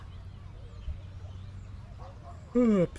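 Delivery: long open-mouthed yawn; big yawn. Real, not acted. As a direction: yawn right through it. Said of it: "Sorry, big yawn".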